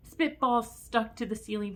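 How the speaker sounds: plain reading voice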